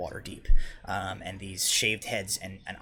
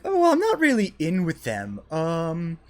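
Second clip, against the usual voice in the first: high, loud character voice; chatty woman pitched way up, explaining herself; wizard woman; 11 semitones higher, 8 dB louder, slower than usual. Acted. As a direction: put on a high, strong feminine voice. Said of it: "She's like".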